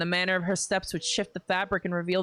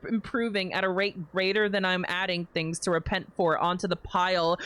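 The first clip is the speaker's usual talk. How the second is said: ordinary delivery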